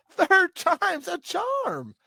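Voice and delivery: high, loud, drawn-out delight; gleeful exclamation. Real, not acted. Co-host seizing on the chapter's ending, gleeful. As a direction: crow it slowly with a laugh.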